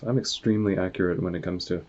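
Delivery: ordinary delivery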